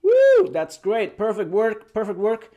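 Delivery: high, loud whoop into praise; celebrating. Real, not acted. Teacher congratulates learners, whooping praise. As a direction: cheer them with a big woo.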